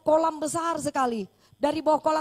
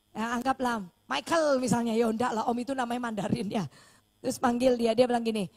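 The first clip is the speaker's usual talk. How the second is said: usual speaking voice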